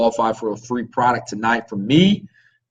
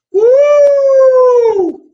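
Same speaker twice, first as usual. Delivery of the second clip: loud high wolf howl; 10 dB louder than usual. Pretend. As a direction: throw back your head and howl. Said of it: "I'm gonna bring my wolf howl back next week, but I'm gonna do it myself tonight".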